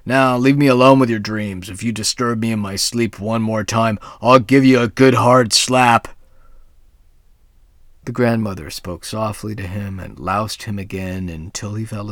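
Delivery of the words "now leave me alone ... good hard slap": loud, gruff angry warning; threatening devil. A narrator's reading. The devil threatens his grandmother, loud and angry. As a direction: threaten her with a slap.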